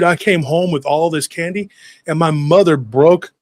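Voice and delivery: ordinary delivery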